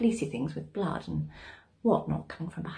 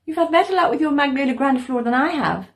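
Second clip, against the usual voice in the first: loud, higher neighbourly call; 7 semitones higher, 10 dB louder than usual. A narrator's reading. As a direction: call it across to a neighbour in her garden. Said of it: "I called to her".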